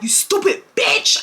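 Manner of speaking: going off. Retold or imitated. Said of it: "I went off on Kyra".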